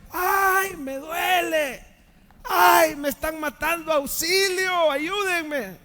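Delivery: high, loud wailing cries for help; mock cries of pain. Pastor mimics what Jesus did not cry, loud pained wailing. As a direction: wail like a man in agony, overplayed. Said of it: "lo primero que Jesús gritó no fue".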